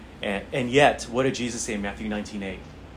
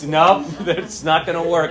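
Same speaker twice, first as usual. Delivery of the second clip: laughing, higher, louder mock Adam; playful Adam voice; 5 semitones higher, 6 dB louder, slower than usual. Preacher acting out Adam rejecting the animals, laughing. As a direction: ham up Adam turning the animals down. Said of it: "really exciting for Adam".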